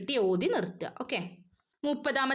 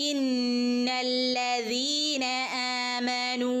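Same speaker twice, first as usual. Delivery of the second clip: slow chanted ഇന്നല്ലദീന; recitation; 2 semitones higher, much slower than usual. Shown as a demonstration. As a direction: recite it slowly, nasal held. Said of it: "രണ്ടനക്കം നീട്ടി ഓതി കൊടുക്കുക". (സാവധാനം ഓതുക)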